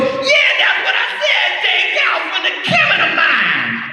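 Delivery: very high, screechy impersonation; high-pitched indignant holler; exasperated woman stranger. Retold or imitated. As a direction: screech it back insistently, mimicking her. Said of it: "She goes".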